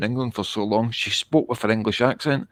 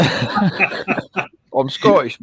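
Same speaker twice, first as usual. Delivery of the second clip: laughing; 6 semitones higher, 3 dB louder, much slower than usual. Real, not acted. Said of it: "Yeah, yeah".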